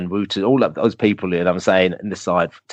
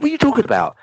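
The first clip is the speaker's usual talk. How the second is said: much higher, baffled retort; incredulous; faster than usual. Retold or imitated. Replying to the German fan's boast, highly incredulous. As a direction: shoot it up high in disbelief. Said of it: "I was like".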